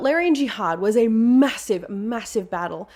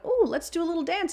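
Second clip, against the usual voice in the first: high, playful little voice; a light, higher-pitched whim; playful robot; 9 semitones higher, 4 dB quieter than usual. Acted. Voicing the robot breaking into a dance, playful.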